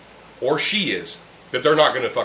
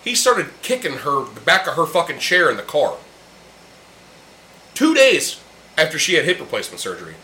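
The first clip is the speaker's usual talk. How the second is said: ordinary talk, nothing marked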